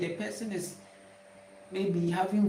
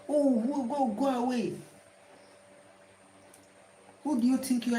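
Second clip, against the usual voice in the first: raised, louder angry retort; angry man's retort; 5 semitones higher, 3 dB louder than usual. Retold or imitated. Angry man snapping at him to go away. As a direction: snap back like the offended man. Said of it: "the man became angry".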